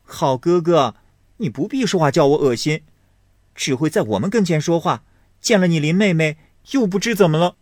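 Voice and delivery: high, fast, sharp teasing; lightly voiced girl scolding her cousin; mocking young girl. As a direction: needle him with quick scorn. Xiangyun mocks Baoyu.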